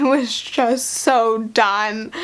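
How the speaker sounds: slow, dragged-out and weary; slow, heavy so done; drawn-out done. Real, not acted. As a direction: stretch every word like you're exhausted.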